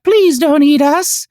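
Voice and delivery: high, slow, tiny pleading voice; pleading food voice. Pretend. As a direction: beg like a frightened liver. Sentient liver and onions plead.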